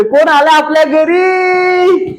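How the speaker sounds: high, slow, drawn-out excited call; excited reveal. Real, not acted. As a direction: build up the surprise guest. Excitedly introducing child on camera. (उत्साहाने विचारणे)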